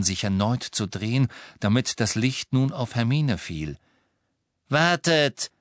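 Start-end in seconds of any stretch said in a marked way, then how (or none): 4.7-5.5 sharp, high interjection. A narrator's reading. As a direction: cut in sharply. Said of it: "sagte Narcissa scharf". (scharf, mit erhobener Stimme)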